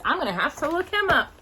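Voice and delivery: plain reading voice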